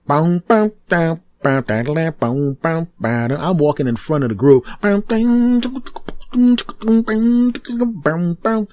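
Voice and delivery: hummed mock walk-out music, boom boom; beatbox a strutting entrance theme; vocal beat. Beatboxing a hero's walk-out theme, swaggering.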